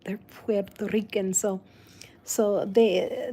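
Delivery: ordinary delivery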